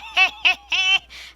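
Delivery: high cackling laugh; loud laugh. Acted. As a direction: crack up laughing. Sid laughing.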